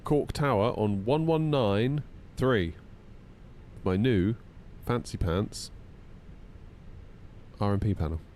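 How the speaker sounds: ordinary talk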